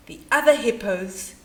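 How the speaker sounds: ordinary delivery, nothing marked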